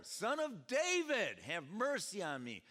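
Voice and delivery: high, loud cry easing off at the end; acted desperate shout. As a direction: cry out over a crowd, stretching the first words. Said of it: "Shouted louder".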